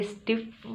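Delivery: स्टिफ with a long double F ending; wrong pronunciation. Shown as a demonstration. Teacher rejects the mistake, demonstrating the wrong way. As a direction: lengthen the final F in स्टिफ. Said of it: "नहीं है".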